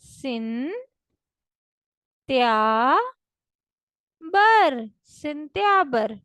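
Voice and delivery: त्या and बर loud, split apart; syllable by syllable, then सिंत्याबर whole; Russian sentyabr. Shown as a demonstration. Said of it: "दोबारा repeat करें मेरे साथ". (सिन... त्या... बर, फिर सिंत्याबर)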